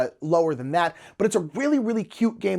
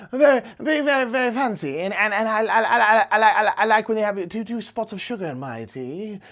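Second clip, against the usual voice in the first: bumbling British aristocrat; 2 semitones higher than usual. Shown as a demonstration. Game host demoing his tea-party dinosaur character, bumbling aristocrat voice. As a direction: play a dim posh gent sipping tea. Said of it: "this is a, a character I like to do".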